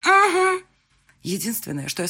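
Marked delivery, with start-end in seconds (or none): 0.1-0.6 much higher, loud squeak; high strained reply. Acted. Bride squeaking a reply to fiancé mid-climax. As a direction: force out a yes.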